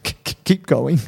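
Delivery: high, halting, stammered through mock tears; imitated crying. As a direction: act out choking back sobs while insisting. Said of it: "I was just crying uncontrollably".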